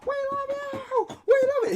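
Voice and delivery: very high, loud squealing fan voices; high-pitched fangirl squeals; screaming fans. Acted. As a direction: shriek your love like a starstruck fan.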